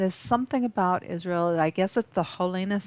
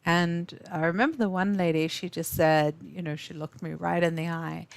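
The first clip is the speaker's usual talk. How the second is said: ordinary talk, nothing marked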